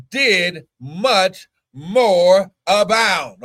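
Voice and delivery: very slow proclamation; drawn-out preaching. Acted. Preacher ending on grace, very slowly drawn out. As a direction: stretch every word out.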